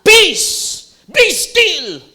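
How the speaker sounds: high, loud stern command; commanding shout. Shown as a demonstration. Jesus commanding the storm, loud and stern. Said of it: "ini-imagine natin".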